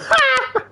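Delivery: high-pitched burst of laughter; squeaky laugh. Real, not acted. Reacting to card rating.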